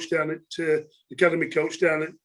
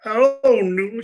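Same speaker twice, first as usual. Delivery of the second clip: raised, louder mock Herefordshire greeting; Herefordshire accent; 5 semitones higher, 5 dB louder than usual. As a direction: imitate a woman's Herefordshire accent. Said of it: "in that Herefordshire accent".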